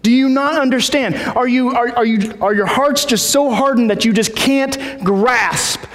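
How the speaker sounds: high, insistent rebuke; exasperated teacher, played up; acted exasperation. Acts out exasperated Jesus rebuking disciples.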